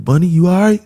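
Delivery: imitated police officer; concerned cop. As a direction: call out to a friend on the ground. Cop greeting him, retold.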